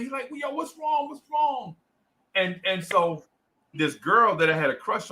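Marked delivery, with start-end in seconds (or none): 0.3-1.7 high, softer worried voice; mock-worried high pitch; imitated concerned friend. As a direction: ask gently in a much higher kid voice. Friend asks crying boy, concerned.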